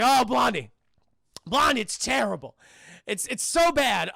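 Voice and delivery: put-on wail of bad news; mock dismay. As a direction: lament loudly with fake grief. Tuco acting grief at dying Blondie.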